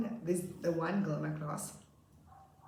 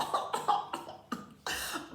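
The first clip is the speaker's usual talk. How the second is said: uncontrolled giggling fit; laughing. Retold or imitated. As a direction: burst of helpless laughter. Retells trying not to laugh.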